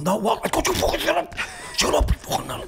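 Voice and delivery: high strained squeak, imitating exasperation; mock squealed hushed protest; acted quarrel. Acted hushed quarrel with woken wife.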